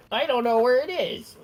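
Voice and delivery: high mocking whine; squeaky put-on voice imitating the confused guy; mocking imitation. Mocking the lost player in a high whiny voice.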